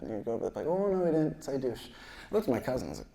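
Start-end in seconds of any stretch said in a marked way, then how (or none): 0.7-1.9 put-on little kid. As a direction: protest like a small kid, pitched up. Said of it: "this kid walks up to us".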